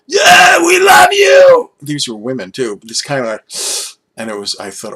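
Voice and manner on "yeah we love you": high, loud shrieking cheer, dragged out slowly; screaming fans. Retold or imitated. Mocking the loud fans behind him, slow high screaming cheer. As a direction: imitate fans screaming over the opener. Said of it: "they were going like".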